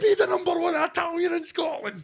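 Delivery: rising to a high frantic yell; ranting at losing to a tribute act, mock furious shout; mock outrage. Pizza chef ranting about coming second, hammed-up yell. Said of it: "and I'm like".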